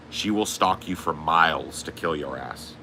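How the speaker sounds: usual speaking voice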